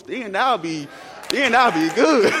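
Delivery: high, loud shout building to good; preacher building to a shout over student loans; preaching shout. Real, not acted. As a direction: ring out then I'll be good to the crowd. Preacher climaxes a thought.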